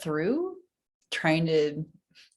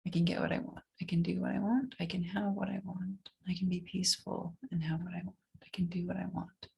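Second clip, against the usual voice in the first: whispered affirmations; 2 semitones higher, 6 dB quieter than usual. Acted. Whispered self-affirmations while tapping.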